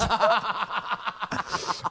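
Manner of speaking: high burst of real laughter; big laugh. Real, not acted. Laughing at the insecure question being about him.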